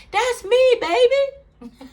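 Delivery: high, loud, gleeful mock squeal; imitating the church lady. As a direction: sing it out high as the lady owning up. Acting out the lady's delighted answer.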